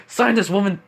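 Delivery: acted-out insistent order; demanding. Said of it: "basically demand the woman".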